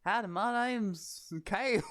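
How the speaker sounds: hammed-up Southern cowboy greeting with a Southern accent. GM voicing Kale, mocking the table in a Southern accent. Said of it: "Just everyone turns southern".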